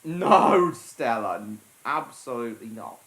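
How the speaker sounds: sharp, loud no; firm scolding. Real, not acted. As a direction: tell the dog off firmly. Scolding the dog.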